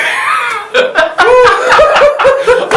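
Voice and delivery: high, shrieking burst of laughter; real, loud cackling laugh. Real, not acted. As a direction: burst out laughing at full volume. Laughs at his own joke.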